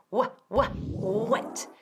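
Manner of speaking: "wa, wa, wet" slowly sounded out; wet built from wa. Shown as a demonstration. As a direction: break "wet" into its opening sound. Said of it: "quita la primera sílaba". ("wet" por partes, empezando por "wa")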